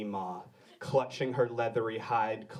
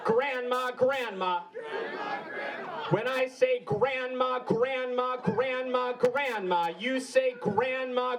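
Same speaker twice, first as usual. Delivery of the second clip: call and response; 11 semitones higher than usual. Acted. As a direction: lead the crowd in a chant. Call-and-response chant with crowd.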